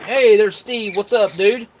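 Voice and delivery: high, loud hello to the dog; excited greeting. Real, not acted. Greeting Steve as he wanders over, bright and loud. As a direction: call out happily to Steve.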